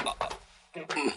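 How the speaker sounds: faint breathy whisper, slowed; weak whisper. Acted. Sick old master whispering to himself, faint and weak. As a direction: barely voice it, drained of strength.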